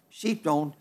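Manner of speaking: a quiet afterthought echo. Shown as a demonstration. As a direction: let it drop away to a hush. Repeats the line for effect.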